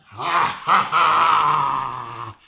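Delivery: booming, drawn-out villain laugh; big wolf laugh. Acted. Big wolf arrives to eat Tom, booming laugh. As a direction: roar out a huge menacing ha-ha.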